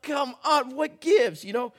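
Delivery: high, loud exasperated complaint; husband scolding his silently laughing wife, hammed-up anger; mock furious protest. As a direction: demand an answer, mock outrage. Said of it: "I'm like".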